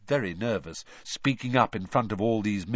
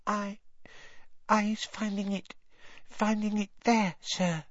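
high, small, halting elf voice; whispering Winky; 11 semitones higher, 3 dB quieter than usual. A narrator's reading. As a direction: falter in a tiny frightened voice. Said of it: "she whispered".

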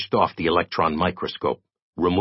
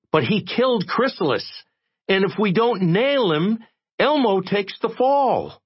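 much higher, dogged insistence; stubborn. Acted. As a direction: dig in your heels.

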